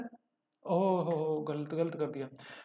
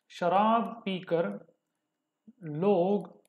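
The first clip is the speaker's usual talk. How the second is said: slow dictation; much slower than usual. Shown as a demonstration. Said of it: "teacher dictates example".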